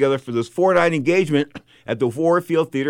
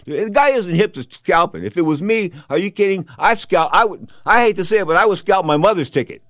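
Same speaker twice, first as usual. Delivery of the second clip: raised, louder voice giving advice to a friend; loud retold advice; 7 semitones higher, 6 dB louder than usual. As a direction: belt it out like you're lecturing your buddy. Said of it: "I go".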